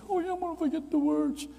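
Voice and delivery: put-on high old professor voice; old man's voice.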